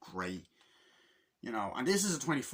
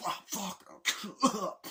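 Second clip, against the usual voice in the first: high, strained curse; panicked mock cry; 9 semitones higher than usual. Gunman under fire in a shootout, panicked cry.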